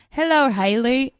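badly faked British greeting; horrible British accent. Host greets their friend again, clumsy fake British accent. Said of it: "I have a horrible British accent".

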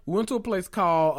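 ordinary talk, nothing marked

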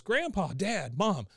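lightly acted out-of-breath call; Bobby. Bobby bursts into interrogation, high breathless call. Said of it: "he is out of breath".

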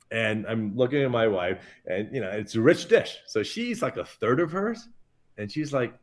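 ordinary talk

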